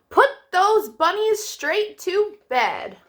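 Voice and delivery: high, loud, slow storybook doctor; firm doctor's order. Acted. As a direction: boom it out like the bossy doctor. Doctor voiced in kids' story.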